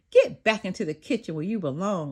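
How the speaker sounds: plain reading voice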